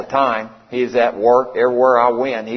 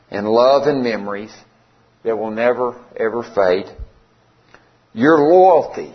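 slow, measured tribute with long pauses; heartfelt spoken eulogy in verse; poem recitation; 4 semitones lower than usual. Real, not acted. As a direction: recite it low and deliberately, line by line. Recites his poem to his dog.

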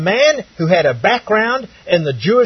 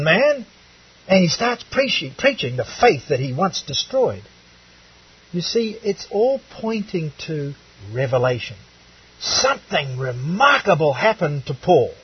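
ordinary talk

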